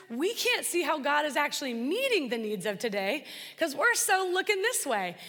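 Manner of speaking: ordinary talk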